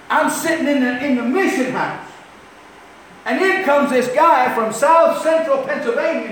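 high, loud storytelling from the pulpit; forceful preaching. Real, not acted. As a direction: push the story out to the congregation.

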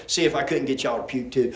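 ordinary talk, nothing marked

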